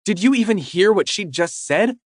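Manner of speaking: put-on high, agitated girlfriend; upset confrontation. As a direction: demand answers, hurt and angry.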